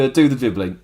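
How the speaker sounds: ordinary delivery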